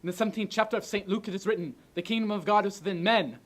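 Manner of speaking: high, loud, impassioned shouting; ranting dictator speech. Acted. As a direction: rouse the soldiers with full force. Rehearses climax of speech.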